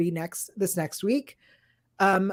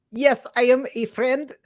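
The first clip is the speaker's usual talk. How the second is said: slightly raised, put-on Russian accent. Co-host reads listener's five-star review, put-on Russian accent. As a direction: read it out in a hammy Russian accent. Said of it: "read it in her Russian accent".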